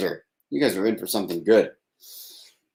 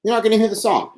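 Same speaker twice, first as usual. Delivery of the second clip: higher, whiny teasing take; mock high lament; 10 semitones higher than usual. Shown as a demonstration. To listeners who missed the song, a higher mock whine. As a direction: pitch it up like a mock complaint. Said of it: "It's all an act".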